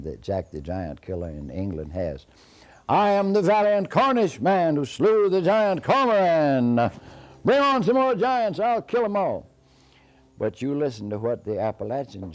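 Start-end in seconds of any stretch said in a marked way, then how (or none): 2.9-9.5 high, booming boastful proclamation; bragging rhyme. Acted. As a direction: declaim it loud and high like a boastful hero. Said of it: "he is always bragging".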